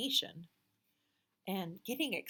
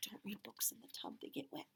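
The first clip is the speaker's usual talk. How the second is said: soft whisper; playful whispered tip; whispered warning; 3 semitones higher, 10 dB quieter than usual. Real, not acted.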